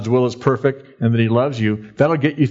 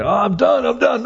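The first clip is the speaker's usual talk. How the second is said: whiny exhausted lifter; 8 semitones higher than usual. Retold or imitated. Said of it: "And you go".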